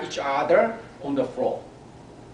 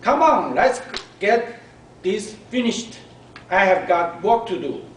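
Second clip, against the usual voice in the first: impatient mom; 4 semitones higher, 6 dB louder than usual. A narrator's reading. Busy mom hurrying her daughter.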